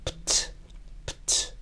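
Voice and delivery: "Pt" whispered as p and t with no vowel; voiceless, soft p-t, nothing between; bare p-t cluster. Shown as a demonstration. Teacher demonstrating the cluster to students, whispered. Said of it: "Pronuncian la P y T sin vocales".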